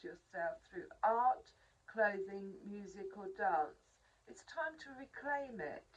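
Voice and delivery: measured, earnest reading voice; oracle card reading. Acted. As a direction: read the card like a gentle message. Reads the oracle card text.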